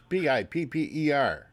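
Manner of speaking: ordinary talk, nothing marked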